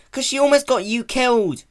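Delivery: sharp, high, loud snap; snapped. Acted. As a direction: snap it out in alarm. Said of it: "he snapped".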